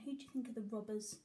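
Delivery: ordinary delivery, nothing marked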